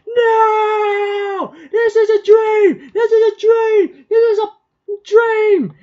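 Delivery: panicked high shouted refusal; screaming denial. Retold or imitated. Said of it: "He screams".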